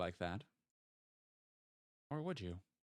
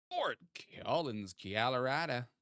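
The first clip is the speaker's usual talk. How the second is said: Fort Collins Colorado in a lightly put-on mystery accent, vowels bent; unknown odd accent; 3 semitones higher, slower than usual. Streamer hamming a place name in a mock accent. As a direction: drawl Colorado as kiah-lo-rah-duh in a half-joking unplaced accent. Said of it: "I don't know what that accent was".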